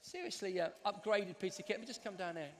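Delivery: ordinary talk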